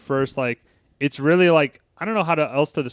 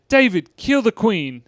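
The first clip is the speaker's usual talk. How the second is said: higher, louder urgent order; quoting Liz; 8 semitones higher, 4 dB louder than usual. Retold or imitated. Liz telling David to stop the jukebox, higher and louder. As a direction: bark it as a sudden order. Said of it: "He's like".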